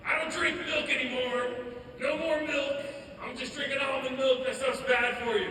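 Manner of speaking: high, loud mock preachiness; health-nut brother. Brother lectures about milk, high mock health-nut voice. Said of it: "'cause he's all like".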